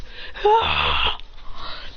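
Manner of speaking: high breathy unvoiced sigh. Mid-fight, a high breathy sigh. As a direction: let out a thin airy sigh. Fight scene sound effect.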